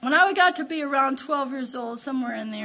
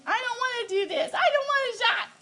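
put-on high, whiny little girl begging; scared child; 8 semitones higher than usual. Little girl begs her mom to skip the shot, high and whiny. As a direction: whimper it like a frightened kid.

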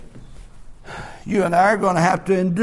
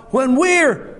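full-force preaching; 8 semitones higher than usual. Acted. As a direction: drive it home to the congregation. Preacher builds again.

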